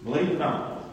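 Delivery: 1 quiet stark statements. Acted.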